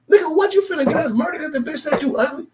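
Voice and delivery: very high, loud squeal of outrage; outraged disbelief. Retold or imitated. Friend scolding his homie at the party, high outraged squeal. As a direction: screech it, appalled.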